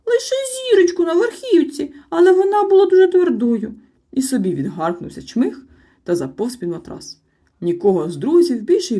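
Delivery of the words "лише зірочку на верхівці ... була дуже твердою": very high, loud squeaky excuse; small creature squeak. A narrator's reading. Sniff's squeaky voice. (високий писклявий голосок)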